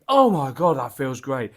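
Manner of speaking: loud delighted gasp of praise; excited exclaim. Retold or imitated. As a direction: blurt it out with delight. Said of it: "I nearly just went".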